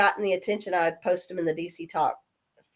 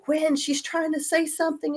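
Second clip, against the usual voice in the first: a hint of a worried son, a bit higher; lightly voiced son; 9 semitones higher, 3 dB quieter than usual. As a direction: son urging his sister, voiced lightly. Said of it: "my son's going".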